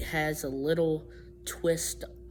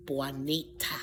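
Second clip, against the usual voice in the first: Spanish accent; 4 semitones lower, 6 dB quieter than usual. Shown as a demonstration. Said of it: "They have more of that French or Española".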